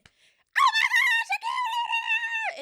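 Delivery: very high, drawn-out mock scream. Cyclops reacts to a pitchfork in his eye, screaming. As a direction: scream it in exaggerated agony. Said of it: "got up and was screaming".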